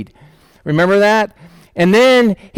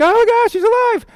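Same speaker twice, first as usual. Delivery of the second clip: much higher, squealing with joy; excited squeal. Acted. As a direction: shriek it out like a thrilled girl at the door. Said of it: "because I'm so excited".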